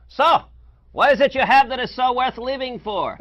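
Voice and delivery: shouting Miracle Max. Retold or imitated. Miracle Max yelling at dead Wesley, high and loud. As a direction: bellow the question at the body. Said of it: "and says".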